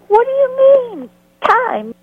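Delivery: high, loud sarcastic retort. Retold or imitated. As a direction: throw the word back at her, mocking. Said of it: "I've never seen such sarcasm".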